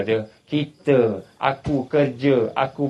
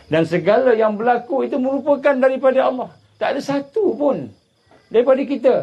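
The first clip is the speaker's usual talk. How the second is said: raised, driving sermon voice, building the drama; preaching at full force; 13 semitones higher, 4 dB louder than usual. Acted. Preacher to his congregation, telling of the tsunami, fired-up sermon delivery. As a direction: push the story like a fiery preacher. Preacher insists all is from Allah.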